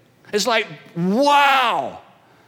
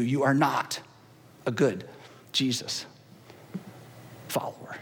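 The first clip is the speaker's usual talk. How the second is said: fading to a whisper; hushed warning; 8 semitones lower, 7 dB quieter than usual. Retold or imitated. Paul warning Christians, fading from a soft voice to a whisper. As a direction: drop softer and softer until it is only whispered.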